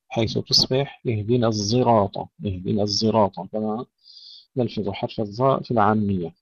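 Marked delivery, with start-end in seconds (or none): 1.1-2.2 الزراط starting with emphatic z; ishmam. Shown as a demonstration. Teacher showing Hamza's reading, emphatic z in الزراط. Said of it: "إشمام الصاد صوت الزاي". 2.5-3.4 الزراط starting with emphatic z; ishmam. Shown as a demonstration. Teacher showing Hamza's reading, emphatic z in الزراط. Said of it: "إشمام الصاد صوت الزاي".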